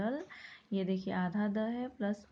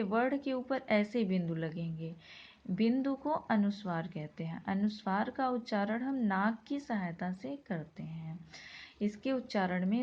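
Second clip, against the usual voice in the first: normal talking voice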